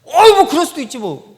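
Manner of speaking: loud, high irritated retort; acted defensive outburst; snappish brush-off. Wrongdoer brushing off the fault, loud and irritated. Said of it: "이렇게 되는 거예요".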